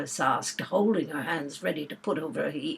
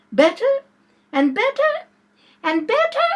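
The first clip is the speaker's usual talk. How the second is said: each "better" higher until "better" ends in a squeak; rising to a squeak; 7 dB louder than usual. A narrator's reading. Queen getting carried away, voice climbing to a squeak. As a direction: climb in pitch on every "better", end squeaking. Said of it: "Her voice went higher with each "better," till it got to quite a squeak".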